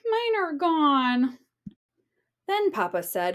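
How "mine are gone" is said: higher, slow whimpering cub voice; crying. Acted. Baby Bear misses his headphones, slow high whimper. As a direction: whimper it like a sad little cub. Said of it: "As Baby Bear cried".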